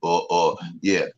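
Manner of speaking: put-on low mumbling old-timer riddle talk; old mechanic voice. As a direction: keep the deep OG mumble going. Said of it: "And they talk like this".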